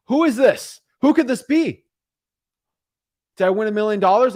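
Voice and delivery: high, loud burst of wondering; eager anticipation. Acted. Seller wondering who is calling, eager anticipation. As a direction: blurt it out, bursting to know. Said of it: "there's a lot of anticipation".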